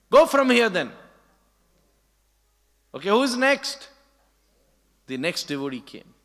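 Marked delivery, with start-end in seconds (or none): 0.1-3.9 loud, high snapping order; angry dismissal. Retold or imitated. Nanda Baba dismissing a devotee, loud and sharp. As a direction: send him away with a shout.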